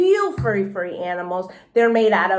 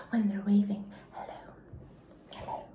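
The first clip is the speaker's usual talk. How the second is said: soft whisper; 3 semitones lower, 13 dB quieter than usual. A narrator's reading. Junie B shows fur hands.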